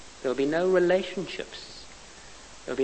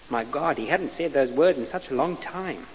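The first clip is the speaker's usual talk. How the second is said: ordinary delivery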